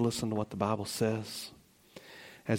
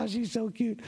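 cooing onlookers; 10 semitones higher than usual. Retold or imitated. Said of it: "everybody's like".

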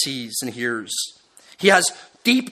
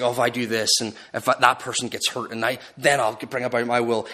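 low, softer scheming aside; detached mock reasoning in a lowered voice; cold, calculating; 6 semitones lower, 4 dB quieter, faster than usual. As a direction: lightly act out someone coldly weighing a plan. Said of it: "with cold calculations".

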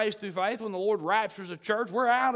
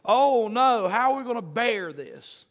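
raised, loud cry of dismay; mock desperation; 4 semitones higher, 6 dB louder than usual. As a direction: act out a despairing wail. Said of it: "in desperation".

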